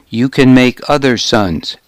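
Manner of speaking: ordinary delivery, nothing marked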